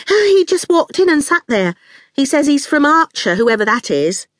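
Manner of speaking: jittery, nervous. A narrator's reading. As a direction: start high and flustered, then ease down. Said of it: "Fifi jittered nervously".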